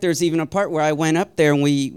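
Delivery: ordinary talk, nothing marked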